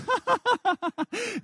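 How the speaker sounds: put-on a high, girlish giggle; high giggle imitation. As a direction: giggle like a five-year-old. Imitating daughter's laughter.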